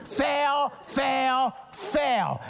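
called out, each fail punched; preacher shouting the verdict; shouted fail. Acted. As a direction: shout it like an umpire. Crowd-style chant of fail.

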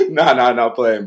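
loud, laughing it off. Real, not acted. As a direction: still laughing, wave it away. Laughing off his joke.

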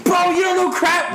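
very high, excited disbelief; incredulous outburst. Real, not acted. Philly fan learns friend never had crab fries, incredulous outburst. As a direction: cry out in friendly astonishment.

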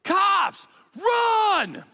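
put-on high, loud panicked-kid yell; imitating a friend yelling out; yelled alarm. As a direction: shout a sudden warning to the gang. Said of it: "all of a sudden yells".

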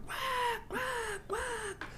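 high squawking quack; bird squawk imitation. Musician retelling the gig, imitating the screeching birds. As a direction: squawk like a panicked parrot.

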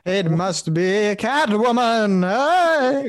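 slow, drawn-out hammy deduction, rising to a cry; Batman. Retold or imitated. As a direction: overdo the melodrama on every word. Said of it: "do this whole thing".

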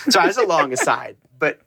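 ordinary talk

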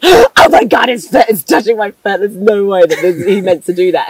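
mock freak-out, high and loud; retold panicky shock. Girl retelling his foot touching hers, mock panicked shock. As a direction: gasp it out in high-pitched disbelief.

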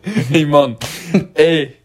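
light mock Jamaican hey mon; a lazy mock Jamaican greeting; Jamaican accent. Teasing the slip into Jamaican, light Jamaican accent. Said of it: "You just brought in the mon".

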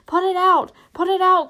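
high, loud desperate yell; crying out. A narrator's reading. Trapped hobbit begging friends to stop the fire, panicked cry. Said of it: "cried Mary".

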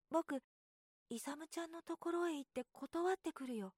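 put-on a child regretting his promise; fretting little boy. A narrator's reading.

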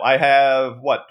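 ordinary talk, nothing marked

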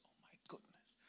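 hushed, breathless oh my goodness; father whispering at the sight of blood; shocked whisper. Retold or imitated.

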